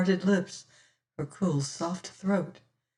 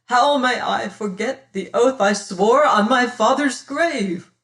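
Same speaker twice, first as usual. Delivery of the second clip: louder and higher, anguished; tormented outburst; 6 semitones higher, 7 dB louder than usual. Acted. Martin protests to Damaris, loud and anguished. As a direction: protest in agony. Martin torn over his oath.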